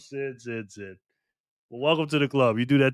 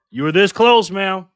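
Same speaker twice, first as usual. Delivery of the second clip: louder, higher exclamation; emphatic near-miss remark; 4 semitones higher, 6 dB louder than usual. Real, not acted. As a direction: push it up and out emphatically.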